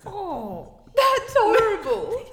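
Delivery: loud, high, slow, laughing "horrible"; real laughing disgust; laughing outrage. Real, not acted. Reacts to the bad pickup line.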